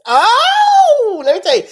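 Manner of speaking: high laughing burst of delight; a real squeal at the thought of the food; excited oh. Real, not acted. Speaker gushing about Trini food, high laughing excited oh.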